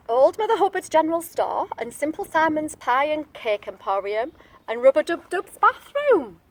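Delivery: ordinary delivery, nothing marked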